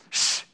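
sharp hissed shushes, spaced out; impatient hushing, acted out; mock husband's shush. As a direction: shush someone talking over the show.

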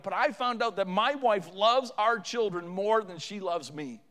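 loud, driving sermon delivery; forceful, pressing testimony from the pulpit; impassioned preaching. Real, not acted.

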